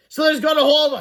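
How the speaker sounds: high, loud character voice; acted panic; alarmed cry. A narrator's reading. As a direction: cry out when suddenly grabbed. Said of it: "cried Cheeseball".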